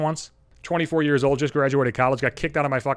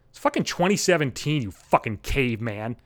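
ordinary delivery

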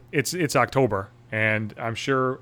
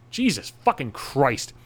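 usual speaking voice

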